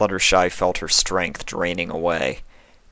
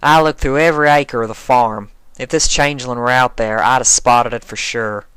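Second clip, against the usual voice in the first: a tough farm pony voice; plain-spoken Applejack voice; country farm mare; 5 semitones higher, 6 dB louder than usual. Acted.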